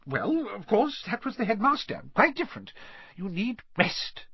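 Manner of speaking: high-pitched, fussy scolding; indignant matron laying down rules; strict matron. A narrator's reading. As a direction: insist on it as a starchy nurse. Strict matron argues with patient.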